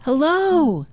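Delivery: put-on boy calls high toward the woods; calling out. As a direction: call hello again, hopeful. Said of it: "and he says".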